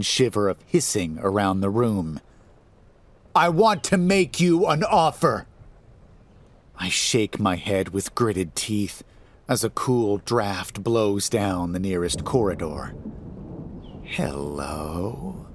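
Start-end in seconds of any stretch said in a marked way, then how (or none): 3.3-5.5 high, loud and bold; raised voice. A narrator's reading. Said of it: "raises his voice". 14.0-15.5 low, soft sinister hello; sinister creature voice. A narrator's reading. Creepy monster voice greeting.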